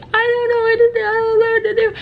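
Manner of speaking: high, loud, fast crying wail; crying plea. Retold or imitated. Crying to the head security guard, high and tearful. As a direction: wail it out through tears. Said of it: "I'm crying in his face".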